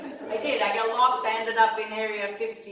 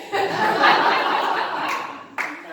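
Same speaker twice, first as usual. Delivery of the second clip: laughter; 8 semitones higher, 8 dB louder than usual. Real, not acted. Laughs at own joke.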